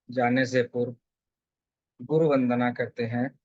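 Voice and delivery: calm, measured meditation guide; settled, evenly paced guidance with long pauses; guided meditation. Real, not acted. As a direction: lead them gently into stillness, phrase by phrase.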